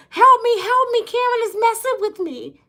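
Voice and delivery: high, loud hammed-up screaming for help; mock scream for help. Shown as a demonstration. Pretending Karen is attacking you, hammed-up screaming. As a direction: holler it like a fake victim. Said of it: "just scream and holler".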